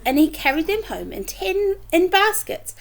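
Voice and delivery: ordinary delivery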